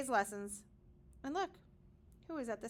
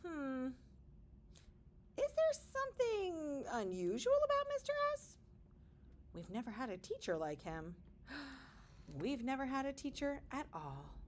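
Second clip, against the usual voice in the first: put-on wondering kids; 3 semitones higher, 4 dB quieter than usual. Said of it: "some students began to wonder".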